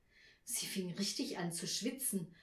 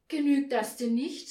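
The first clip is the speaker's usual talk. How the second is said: high, loud, put-out query; raised, pleading question; dismayed question; 5 semitones higher, 8 dB louder than usual. A narrator's reading. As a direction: ask in anxious disbelief. Frau Schilling questions the strict Nikolaus.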